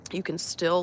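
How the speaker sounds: usual speaking voice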